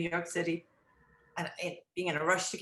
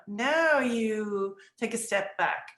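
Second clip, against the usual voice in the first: ordinary talk, nothing marked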